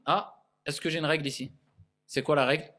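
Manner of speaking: usual speaking voice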